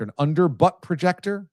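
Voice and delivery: usual speaking voice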